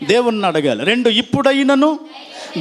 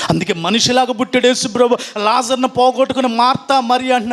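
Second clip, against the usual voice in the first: ordinary delivery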